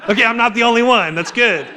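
mock relief at not being alone; relieved aside. Listener realizing others feel awkward too, quick relieved aside. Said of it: "It's just like".

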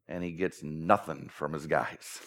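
low, slow, soft deadpan; dry muttered punchline; deadpan aside. Acted. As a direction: let it land flat and slow. Deadpan beat after the punchline.

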